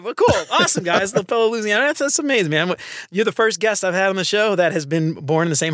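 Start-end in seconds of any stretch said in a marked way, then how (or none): 0.0-2.7 high, delighted exclamations; excited. Real, not acted. Host marvels at fellow Louisiana guest, excited and high. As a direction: let the pitch fly up with real glee.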